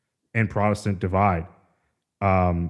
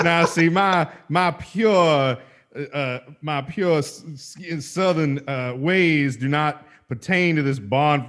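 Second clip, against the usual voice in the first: high, slow Southern belle drawl; hammed-up genteel Southern accent; thick Southern accent; 4 semitones higher, slower than usual. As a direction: fan yourself like a delicate Southern lady. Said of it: "thick Southern".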